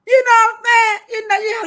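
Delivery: squealing through laughter, high and loud; high laughing squeal. Real, not acted. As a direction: crack up mid-question. Cracking up at own joke.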